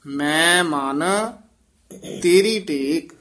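ਮੈਂ ਮਨ set apart from ਤੇਰੀ ਟੇਕ; halting phrasing. Shown as a demonstration. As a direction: clear pause after ਮੈਂ ਮਨ, then ਤੇਰੀ ਟੇਕ. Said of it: "ਇੱਥੇ ਠਮਕ ਲਾ ਦੇਣੀ ਹੈ".

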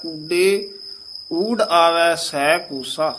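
ਊਡੇ pressed, then a pause, slow rest; firm ਊਡੇ, pause. Shown as a demonstration. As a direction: lean on ਊਡੇ and break. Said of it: "ਇੱਥੇ ਯਮਕ ਲਾ ਦੇਣੀ ਹੈ". (ਊਡੇ ਤੇ ਯਮਕ)

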